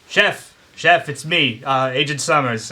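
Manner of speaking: calling out. Acted. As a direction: call after someone walking away. Calling out to fleeing chef.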